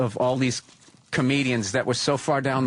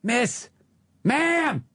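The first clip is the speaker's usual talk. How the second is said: insistent, higher-pitched calls with pauses; calling for attention; 8 semitones higher, much slower than usual. Retold or imitated. Patrice singling out a noisy woman at the bar, insistent calling. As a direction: call out, climbing higher on the last.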